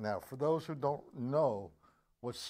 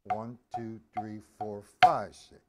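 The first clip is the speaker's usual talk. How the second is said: five and six punched much louder; accent counted on five; 3 semitones lower, 7 dB louder than usual. Shown as a demonstration. Drum teacher showing students where the accent falls, punching five. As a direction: hit five hard as you count. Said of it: "So I get the accent".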